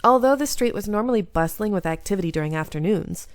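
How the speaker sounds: ordinary talk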